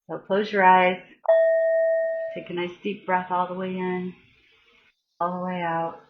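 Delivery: guided meditation. Acted. Guiding viewers to close their eyes, soft meditation voice. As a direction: ease the listeners into breathing.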